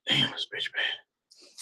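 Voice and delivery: breathless, high, slow whisper of awe; a man stunned by a beautiful woman, whispering; awed whisper. Retold or imitated. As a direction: let it slip out in a stunned whisper. Awed inner reaction.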